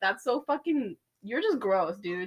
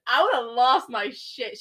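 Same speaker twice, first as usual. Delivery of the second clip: ordinary talk, nothing marked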